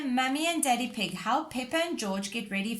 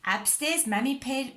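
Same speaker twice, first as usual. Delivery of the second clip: plain reading voice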